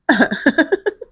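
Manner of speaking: short, high chuckle; laugh. Real, not acted. Amused by their own warning about passing out, short laugh.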